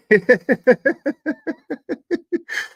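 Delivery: high, loud burst of laughter; real laugh. Real, not acted. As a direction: crack up mid-sentence. Laughing at courtroom people.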